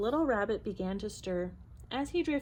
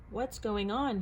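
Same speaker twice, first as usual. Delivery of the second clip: ordinary delivery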